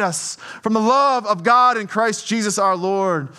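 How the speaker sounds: building scripture reading, rising toward the close; solemn scripture read to the congregation. Acted. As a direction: read each "nor" with growing weight.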